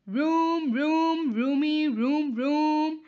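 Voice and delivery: loud mock engine sound; engine noises. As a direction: vroom like a toy bus. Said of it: "he is making sounds as how he would be driving".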